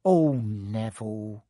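put-on weary sigh from an old gran; old woman sighing. A narrator's reading. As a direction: sigh it out slowly like a grandmother. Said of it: "he heard the old woman sigh".

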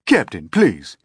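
loud, pressing appeal; officer urges captain to calm down; firm plea. A narrator's reading. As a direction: beg him to stop. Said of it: "the first officer said sharply".

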